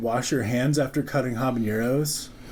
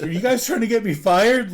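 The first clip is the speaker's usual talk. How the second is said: panicked plea; 8 semitones higher, 4 dB louder than usual. Retold or imitated. Panicking, retold.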